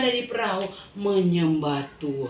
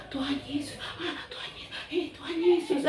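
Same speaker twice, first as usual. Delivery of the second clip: breathless, soft amazed whisper; awed hushed murmur; 4 semitones higher, 8 dB quieter than usual. Acted. Disciples whispering in awe at Jesus. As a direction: murmur in wonder. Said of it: "semua ikut agak".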